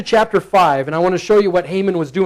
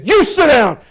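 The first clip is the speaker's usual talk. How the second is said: firm final order; 7 semitones higher than usual. Retold or imitated. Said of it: "Finally, you say".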